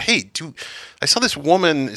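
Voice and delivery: usual speaking voice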